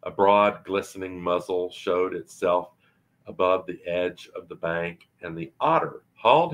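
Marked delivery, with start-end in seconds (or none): none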